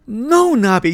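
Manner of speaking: high, loud alarmed cry; yelling. A narrator's reading. Boy yelling at his dog running into the fire. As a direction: yell to stop him. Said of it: "yelled Nick".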